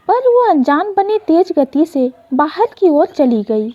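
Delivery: ordinary delivery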